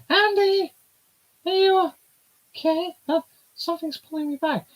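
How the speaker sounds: put-on high-pitched girly character voice; squeaky young girl voice.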